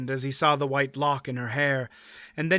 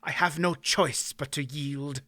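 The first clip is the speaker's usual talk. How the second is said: defeated baron; 4 dB quieter than usual. Acted.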